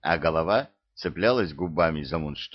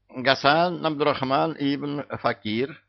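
high, slow happy announcement; cheerful presentation; joyful; 7 semitones higher than usual. A narrator's reading. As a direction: proudly present the name. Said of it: "радостно сообщил Кощей".

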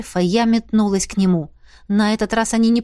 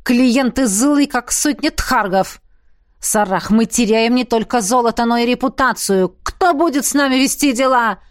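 mildly irritated complaint; indignant, growled reproach; angry growl. A narrator's reading. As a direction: snap in mild anger at a partner. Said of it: "рыкнула незнакомая женщина".

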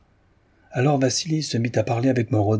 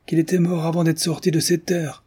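put-on higher, pressing old man's voice, impatient; an impatient old peasant insisting, voice pitched up; 7 semitones higher than usual. A narrator's reading. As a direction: cut her off, tired of explaining. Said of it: "fit Tikhon avec impatience".

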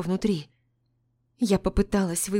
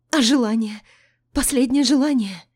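high, loud panicked cry; panicking; 6 semitones higher, 7 dB louder than usual. A narrator's reading. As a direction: grab at hope in panic. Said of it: "запаниковала я".

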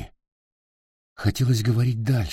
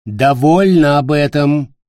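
slow, sharp and loud rebuke; 6 semitones higher, 9 dB louder than usual. Acted. Queen sharply silencing Peter's plea. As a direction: cut him off hard. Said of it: "резко сказала она".

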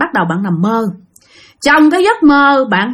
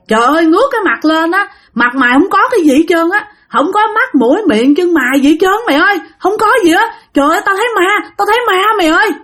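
higher-pitched, alarmed retelling of seeing a ghost; hoảng hốt; 7 semitones higher than usual. Retold or imitated. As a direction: blurt it out like a spooked friend. (giọng hoảng hốt, cao lên)

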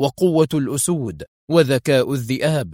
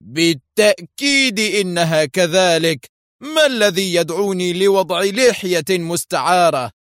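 indignant shout; 6 semitones higher, 3 dB louder than usual. A narrator's reading. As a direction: shout it back, offended. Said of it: "صاح آرثر".

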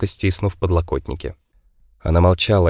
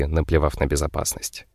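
plain reading voice

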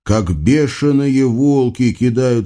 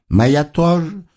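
wistful, higher-pitched reply; mildly forlorn agreement; sighing; 5 semitones higher, slower than usual. A narrator's reading. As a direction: sigh it out in sympathy. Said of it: "вздохнул Наум".

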